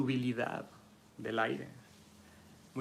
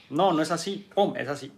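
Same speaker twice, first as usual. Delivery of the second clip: mock firm shutdown; 4 semitones higher, 7 dB louder than usual. Fire person shuts down.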